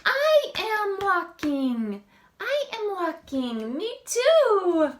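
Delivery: high, loud little chick; a chick copying the duck, high and loud. Acted. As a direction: squeak it out eagerly like a tiny chick. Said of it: "He says".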